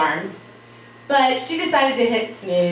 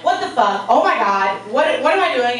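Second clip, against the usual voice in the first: panicked; 4 semitones higher, 4 dB louder than usual. Retold or imitated. Panicked waking late for flight.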